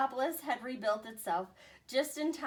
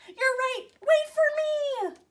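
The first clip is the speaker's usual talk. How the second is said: panicked cry; 10 dB louder, slower than usual. Acted. Commander runs after troops.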